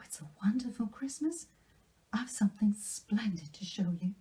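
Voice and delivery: put-on high, breathless delighted girl; young girl bubbling over with Christmas wonder; excited Anne. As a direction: gush with joy over the gift. Anne greets Diana, bursting.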